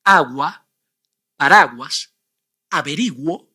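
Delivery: agua, paraguas, averiguo with the u as a w; Spanish words. Shown as a demonstration. Teacher revealing the correct answers. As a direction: sound the u in each after g. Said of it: "If you said".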